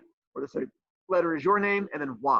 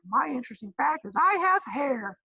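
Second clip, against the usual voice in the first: high, mock-proud voice of a dull student; 10 semitones higher than usual. Teacher imitating a student proudly sharing a dull fact, high and mock-proud. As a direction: pitch it up like a kid boasting something boring. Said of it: "And you're like".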